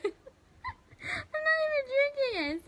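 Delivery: high giggly, slowed words; laughing. Real, not acted. Laughing after spilling wine.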